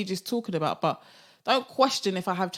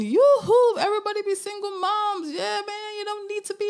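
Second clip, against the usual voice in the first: mock cheerleader; 13 semitones higher than usual. Mocking her imagined critics with a high rallying cry. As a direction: cheer them on like a hammed-up campaigner. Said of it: "This isn't me championing".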